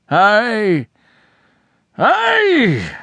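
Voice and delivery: rising, wrenching shouts; animal sob. A narrator's reading. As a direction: howl it like a heart-stricken moose. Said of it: "a terrific loud animal sob".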